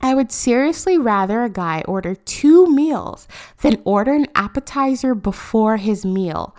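mocking tweet read. Mocking the tweeter's words to her podcast audience, loud. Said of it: "tweet number four says".

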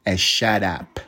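ordinary delivery

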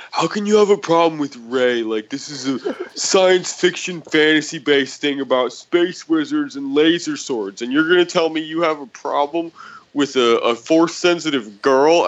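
high, indignant mock voice of an offended commenter; mocking whiny voice. Imitating an online commenter, high mock outrage. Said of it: "replied and was like".

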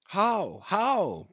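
lightly voiced young men clamouring for the story; young Spaniards pushing the sailor to tell his tale; eager cry. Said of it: "cried all the young Spaniards crowding".

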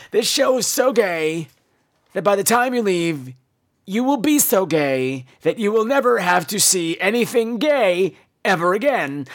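ordinary talk, nothing marked

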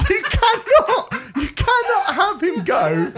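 high, slow words through laughter; laughing disbelief. Real, not acted. Ranting about the film to friends, laughing and high-pitched. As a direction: laugh while you protest. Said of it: "laughing disbelief".

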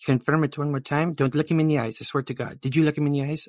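lightly voiced rattled handler; exasperated bystander. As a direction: scold the lady in a fluster.

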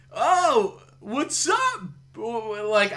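put-on high, drawn-out California surfer accent; mock the Valley dude greeting; hammed-up Valley accent up high. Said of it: "accents in California movies".

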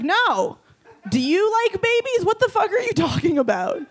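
incredulous. Retold or imitated. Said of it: "I was like".

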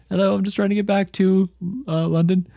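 high, light British gift-shop man; chirpy imitation of a British accent; lightly hammed-up British accent; mock British accent. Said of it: "he's like".

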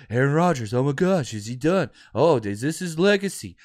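low, sneering parody of pundits; mocking media voice. Fan mocking national pundits who fawn over Rodgers, sneering parody. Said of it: "Blah, blah".

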